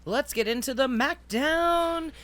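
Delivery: slow, high showman call; announcer voice. Acted. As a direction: announce it like a host. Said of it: "announcing the segment".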